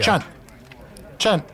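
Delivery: muffled behind hands; hands over mouth. Real, not acted. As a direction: mumble through covered mouth. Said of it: "hands over my mouth".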